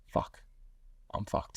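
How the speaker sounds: low, hushed private curse; quiet, sinking aside to yourself; muttered to himself. Retold or imitated. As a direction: mutter it under your breath. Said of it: "went like this to myself".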